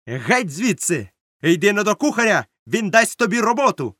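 loud, high-pitched dismissal; shouted order. A narrator's reading. Captain sends the cabin boy to the cook, shouting. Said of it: "крикнув він".

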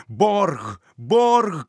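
triumphant double shout. Acted. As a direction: hurl the word out twice, rising. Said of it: "кричав Мауглі".

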